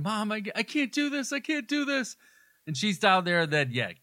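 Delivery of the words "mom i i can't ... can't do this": much higher, rising into a thin mock cry; hammed-up panicked plea, pitched way up; imitating a frightened holler. [name] calling up the stairs to bait Michael, mock panicked cry. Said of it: "she's hollering".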